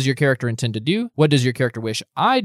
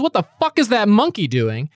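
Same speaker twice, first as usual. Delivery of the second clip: snapping guard; 6 semitones higher than usual. Acted. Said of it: "she snaps back and she says".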